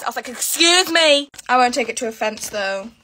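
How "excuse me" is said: slow, drawn-out, higher "excuse me"; mock offended. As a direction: act insulted by your phone. Offended reply to phone.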